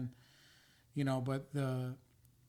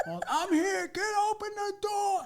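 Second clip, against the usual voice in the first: mock yell; 5 dB louder than usual. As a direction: bellow it like a drunk at four in the morning. Imitating ex yelling at the door.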